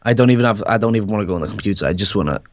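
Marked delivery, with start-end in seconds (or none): none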